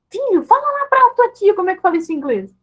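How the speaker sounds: put-on high-pitched show-off parent coaxing a child; imitated pushy parent. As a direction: prompt the child in a high, eager singsong. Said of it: "a gente fala assim, ó". (mãe exibindo o filho, voz aguda)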